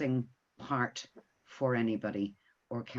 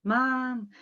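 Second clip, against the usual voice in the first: high, louder mock teenage call for mum; teenager calling mum; 11 semitones higher, 4 dB louder than usual. Mother imitating teenagers interrupting her call, high calling-out. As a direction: imitate a teenager yelling for a parent. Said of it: "I got teenagers".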